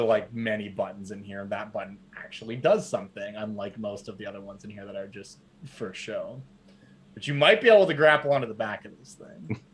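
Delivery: normal talking voice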